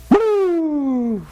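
high, loud whoop; whooping as he swerves past; excited whoop. Retold or imitated. Swerving around the stalled lady.

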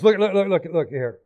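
ordinary talk

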